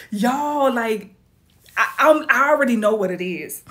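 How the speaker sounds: cracking up while telling the viewers; laughing. Real, not acted.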